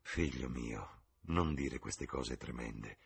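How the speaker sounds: mormorato, più basso, più piano e lento; mormorio. Acted. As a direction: sussurralo piano, con pena. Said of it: "mormorò la signora Vane". (la madre al figlio, mormorando lenta e sommessa)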